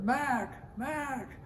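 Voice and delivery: high, soft, drawn-out call; imitated distant call. Lost shipmate answers from the water, imitated high distant call. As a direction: answer as if from far off.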